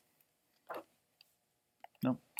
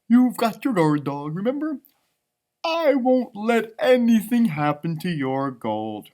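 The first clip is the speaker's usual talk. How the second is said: high, slow and sunny puppy; cheerful puppy voice; 6 semitones higher, 5 dB louder, slower than usual. Acted. Puppy Lucky chirping to Mackenzie about rain. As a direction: bubble over like a happy little dog. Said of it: "said Lucky".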